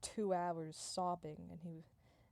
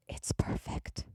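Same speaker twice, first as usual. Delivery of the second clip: loud, forceful rasping whisper; hammed-up voiceless marvelling; awed stage whisper; 7 dB louder, slower than usual. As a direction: whisper it hard, overcome with awe. Awed first step onto the clouds.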